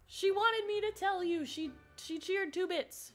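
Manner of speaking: calling out. Real, not acted. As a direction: call it through to someone out of the room. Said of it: "Hang on, let me yell".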